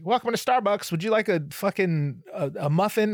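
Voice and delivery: high, chirpy drive-thru greeting; mock barista voice. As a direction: play a cheery barista over the speaker. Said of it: "They're like".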